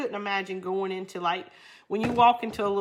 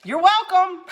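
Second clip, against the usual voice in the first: confused reply; 11 semitones higher, 9 dB louder than usual. Retold or imitated. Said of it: "she went".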